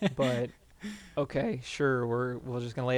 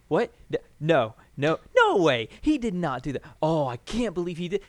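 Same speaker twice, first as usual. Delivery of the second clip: shocked disbelief; 3 semitones higher, 5 dB louder than usual. Retold or imitated. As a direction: sputter in escalating denial, reliving the shock. Acts out own shocked reaction.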